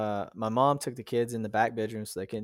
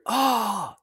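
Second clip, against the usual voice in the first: retold cry; 10 semitones higher than usual. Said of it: "then we heard".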